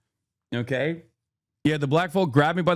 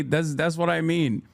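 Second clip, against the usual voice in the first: ordinary talk, nothing marked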